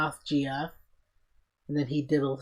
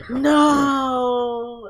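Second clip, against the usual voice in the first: shouted no; 10 semitones higher, 9 dB louder than usual. Retold or imitated. Character's cry in retold scene.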